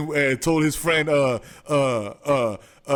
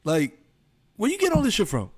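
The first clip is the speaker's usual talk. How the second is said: 1 incredulous; 8 semitones higher than usual. Real, not acted. Friend's shocked high rant.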